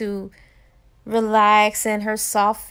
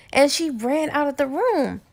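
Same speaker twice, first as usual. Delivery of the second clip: plain reading voice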